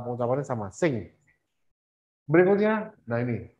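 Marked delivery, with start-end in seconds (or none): none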